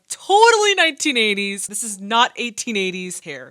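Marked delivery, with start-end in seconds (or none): 0.1-1.7 excited. Real, not acted. Mocking the actors' hair.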